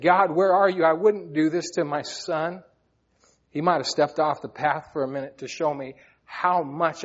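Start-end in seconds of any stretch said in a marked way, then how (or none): 0.0-2.6 desperate cry to God. Retold or imitated. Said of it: "When I said".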